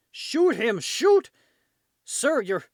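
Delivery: high, loud frantic shouting; panicked yell. Acted. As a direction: scream the order mid-battle. Soldiers yelling in the fight.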